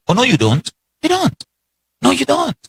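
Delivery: preacher's sharp high rebuttal; emphatic no. Real, not acted. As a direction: rebut them hard, hitting don't.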